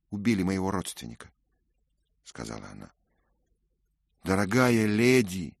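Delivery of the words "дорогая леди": usual speaking voice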